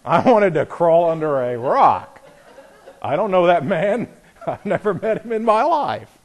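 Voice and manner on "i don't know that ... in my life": laughing through the words. Real, not acted. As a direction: chuckle out the denial. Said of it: "embarrassed joke, laughing".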